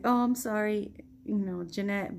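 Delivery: ordinary delivery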